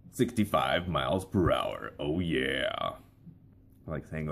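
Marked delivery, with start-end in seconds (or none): 0.1-2.9 slow, low announcer read; reading highway signs in a radio voice; radio voiceover. Shown as a demonstration. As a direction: read the road sign like a cool ad. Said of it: "just reading signs like".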